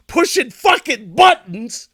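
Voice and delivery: loud, high, slow emphatic rant; exasperated outburst. Retold or imitated. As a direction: punch each word out in mounting frustration. Ranting about ringing all doorbells.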